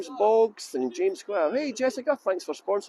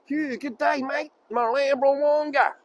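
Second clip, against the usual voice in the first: higher-pitched hammed-up Aussie greeting; mock Australian accent; 5 semitones higher, slower than usual. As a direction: play at an Australian accent, drawing out the last words. Jokey Australian greeting to a viewer.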